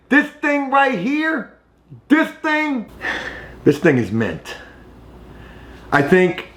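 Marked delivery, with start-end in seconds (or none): 0.1-2.9 high, slow, gleeful showing off; excited. Real, not acted. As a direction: marvel at the find, drawing it out. Thrilled showing off the magazine.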